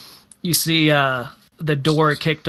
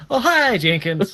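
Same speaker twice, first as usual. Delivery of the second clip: higher sing-song hello; chirpy greeting; 6 semitones higher than usual. Retold or imitated. Townsperson greeting Jenkins, high and sing-song. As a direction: greet him like a townsfolk regular. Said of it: "Jenkins knows everyone".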